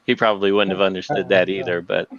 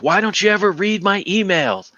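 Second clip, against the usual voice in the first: raised pitch, a hint of an accusing manager; irate boss; 10 semitones higher than usual. A manager scolding his employee over unread emails, accusingly. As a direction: play the boss demanding answers. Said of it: "And he's like".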